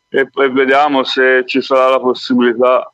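normal talking voice

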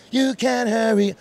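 high, loud, slow sung line. Retold or imitated. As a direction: sing it punchy and bright.

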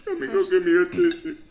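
choked-up, tearful plea for a tissue; crying while laughing it off; real crying. Real, not acted. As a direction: voice cracking high through real tears. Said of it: "Yo, cry baby".

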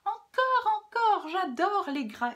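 loud, high-pitched exclamation; piercing eager cry. Acted. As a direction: shout it out with put-on glee. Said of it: "et s'écria".

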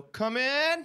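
high, bright come in rising; cheerful invitation. Retold or imitated. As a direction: call it out brightly upward. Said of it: "I just look down and go".

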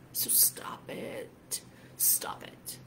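slow, very soft whisper; urgent whisper. Real, not acted. Coach urging listeners to drop their addiction, urgent whisper. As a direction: whisper it as an intimate command.

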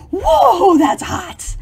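mock burning mouth. Reacting to volcanic heat.

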